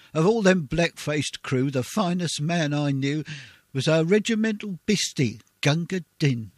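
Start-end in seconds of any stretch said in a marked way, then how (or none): none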